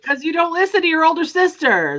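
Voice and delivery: teasing. Real, not acted. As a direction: tease your little brother. Teasing her younger sibling.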